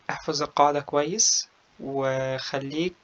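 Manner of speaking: normal talking voice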